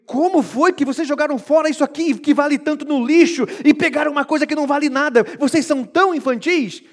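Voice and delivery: bem mais alto e agudo, indignado; pai repreendendo filho. Acted. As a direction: chacoalha o filho com a voz. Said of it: "É um pai dizendo para um filho". (pai repreendendo o filho com voz firme e alta)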